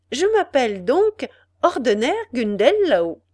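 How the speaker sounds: high, loud delighted exclamation; childlike joy. A narrator's reading. Ethel rejoices in her husband's name, childlike glee. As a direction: savour the new name with glee. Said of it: "avec une joie enfantine".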